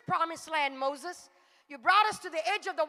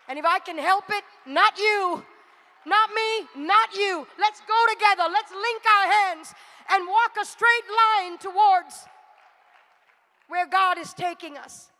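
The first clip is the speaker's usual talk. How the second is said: louder preaching, walking pace slowing; rousing preaching; 2 semitones higher, 7 dB louder than usual. Acted. Preacher urging the church to walk with God, louder and rousing. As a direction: call them to walk together.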